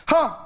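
one sharp high "Ha!"; a lone high laugh, held back; the first of two imitated laughs; first bark laugh. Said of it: "he just raised it slightly".

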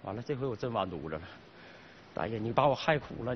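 low, deflated grumble; dejected. Acted. Fired salesman blaming the old man, dejected. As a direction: sink into low, defeated self-pity.

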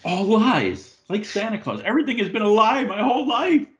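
high-pitched, hammed-up betrayal; mock outrage. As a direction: wail it like your whole world has collapsed, in jest. Mock despair at being disillusioned.

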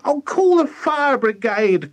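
high, loud call for help; frantic character wail; panicked cry. A narrator's reading. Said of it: "cried Missus Cranky".

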